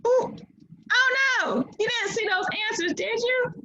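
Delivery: high, squeaky "oh no", teasing the class; mock alarm. Real, not acted. Teacher to students who saw the answers, high mock alarm.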